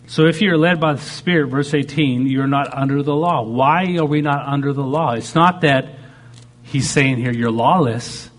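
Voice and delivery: normal talking voice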